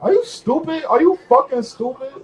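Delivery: high, loud disbelieving yell; incredulous shout. Real, not acted. Streamer yelling in disbelief at a broke rival.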